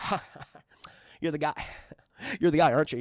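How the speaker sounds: low, halting smarmy voice with a chuckle; smarmy guy. Retold or imitated. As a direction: sidle up with a low smug laugh. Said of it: "this really smarmy asshole".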